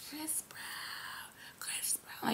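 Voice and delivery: whispered imitation of screaming; barely voiced fan scream; mock scream. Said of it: "because I was screaming".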